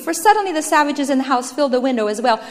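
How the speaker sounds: usual speaking voice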